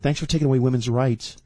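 lightly voiced sneering Beltway type; mock snooty local. Mocking DC locals meeting a Texan.

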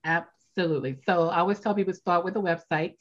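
normal talking voice

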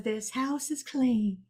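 drawn-out, hushed movie-character declaration; movie quote voice. Acted. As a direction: intone it slowly like a film line. Quoting the same movie.

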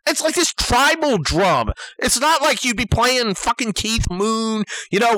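usual speaking voice

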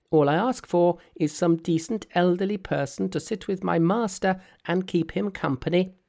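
high, perky monkey king voice; cheeky monkey hero. Acted. Monkey's voice in read story.

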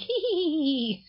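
high squeaky cartoon giggle; cartoon laugh. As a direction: giggle like a tiny cartoon critter. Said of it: "it would be doing that little cartoon laugh".

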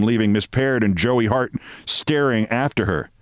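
usual speaking voice

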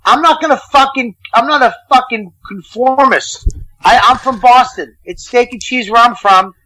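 high, fired-up rant with pitch jumping well up; defending Boston to the hosts, fired up and high. Real, not acted. To the hosts about his hometown, a fired-up high rant.

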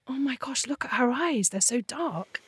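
soft breathless whisper; awed whisper. Retold or imitated. New mother to husband about the baby's dark eyes, an awed whisper. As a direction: whisper it in wonder over the baby. First look at newborn baby.

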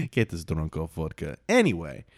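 ordinary talk, nothing marked

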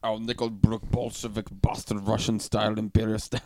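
quick, shaky stab at a Russian accent; badly faked Russian accent; attempted Russian accent. Shown as a demonstration. Host reads the beer label on request, bad Russian accent. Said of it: "Read it in your best Russian accent".

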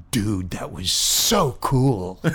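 slow, low dude voice; imitated awed teenager. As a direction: draw it out like an impressed teen. Teenage extra voiced, awestruck drawl.

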